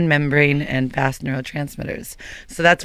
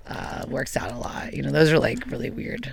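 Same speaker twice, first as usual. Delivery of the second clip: breathy under-the-breath whisper, much softer; whispered aside; 8 dB quieter than usual. Real, not acted. Woman whispering an aside to the group about his list. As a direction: drop to a secret whisper as an aside.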